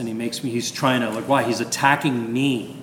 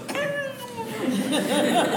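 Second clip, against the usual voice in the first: high laugh. Retold or imitated. Priest impatient, needs bathroom.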